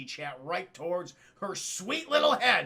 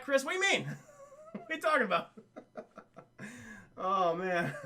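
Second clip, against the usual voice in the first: usual speaking voice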